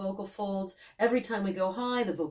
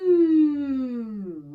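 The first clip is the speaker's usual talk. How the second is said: gliding hum; 5 semitones higher than usual. Shown as a demonstration. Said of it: "And again".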